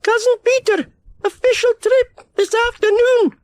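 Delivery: very high, loud grandma mishearing; grandma shouts back the garbled message; old grandma. A narrator's reading. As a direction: repeat it back confidently wrong. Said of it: "repeated Grandma Tyree".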